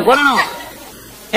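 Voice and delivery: usual speaking voice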